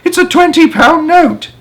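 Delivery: very high, loud, delighted shriek; excited yell. A narrator's reading. Young man jumping, yelling about the note. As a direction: squeal it with disbelief.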